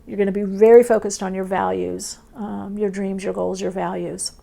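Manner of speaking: ordinary talk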